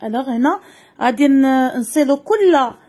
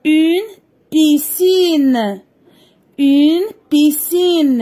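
slow clear dictation; much slower than usual. Shown as a demonstration. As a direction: spell out "piscine" slowly so the pupils hear each syllable. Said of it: "نسمعو مزيان النطق ديال كل كلمة". (نطق بطيء وواضح لكلمة "piscine")

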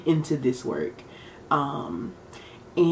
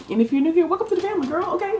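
excited high welcome; 12 semitones higher, much faster than usual. Acted. Welcoming new viewers.

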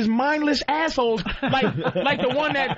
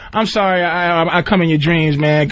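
mock sorry Freddy; 10 semitones lower, 6 dB louder than usual. Freddy pleading his sad backstory, low and mopey. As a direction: lower mopey confession.

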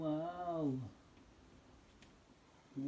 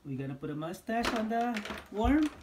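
surprised question; 7 semitones higher, 7 dB louder, faster than usual. Real, not acted.

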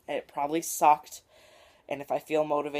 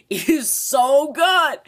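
loud, high, laughing gush; 12 semitones higher, 9 dB louder, much slower than usual. Real, not acted. Fan gushing to viewers about YouTube Rewind, loud and laughing. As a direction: squeal it with delight.

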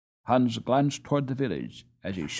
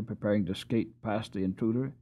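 ordinary delivery, nothing marked

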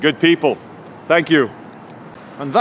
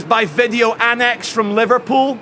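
higher, slow mocking question, imitating her sarcasm; sarcastic; 6 semitones higher than usual. Detective mocks the blogger's question, slow sarcastic imitation. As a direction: drawl it back the way she sneered. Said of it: "she looks at me sarcastically".

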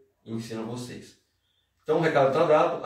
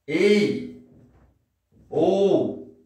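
high, loud, drawn-out calls; Portuguese hailing call; 6 semitones higher, 6 dB louder than usual. Shown as a demonstration. Teacher giving Portuguese equivalents, loud high hailing. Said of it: "em português é tipo assim".

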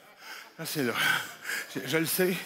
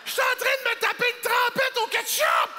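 indignant shout; 12 semitones higher than usual. Acted. Comic ranting to the crowd about ketchup dip, indignant. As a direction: rant it at full volume.

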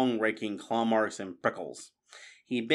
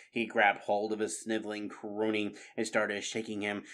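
ordinary delivery

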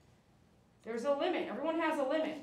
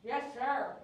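usual speaking voice

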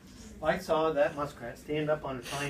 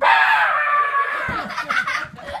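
loud, very high drawn-out shriek; sudden scream; 10 dB louder than usual. Retold or imitated. Storyteller acts out the muskrat surprise, loud high scream.

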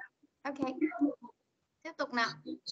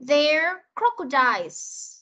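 very slow "There crocodiles", every sound clear; slow model sentence; much slower than usual. Shown as a demonstration. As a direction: model it slowly for young learners. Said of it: "Mình sẽ đọc cả câu nhá".

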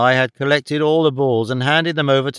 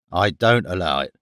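low-pitched refusal from the trainer; curt quip; 6 semitones lower than usual. A narrator's reading. Trainer refusing Holmes his questions, low curt quip. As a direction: refuse curtly in a lower voice. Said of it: "the man quipped".

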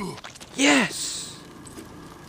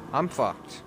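ordinary talk, nothing marked

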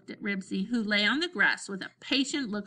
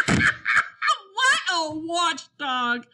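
high, slow, mocking hoot; hooting with laughter; 8 semitones higher, 6 dB louder, much slower than usual. A narrator's reading. Scooter makes fun of Ribsy the watchdog, hooting. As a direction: hoot it loudly, stretching the words. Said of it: "hooted Scooter".

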